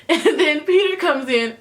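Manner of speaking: ordinary talk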